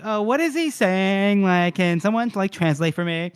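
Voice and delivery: high, plaintive questioning voice; bewildered patient. Retold or imitated. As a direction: plead comically for someone to explain. Bewildered patient begs for translation.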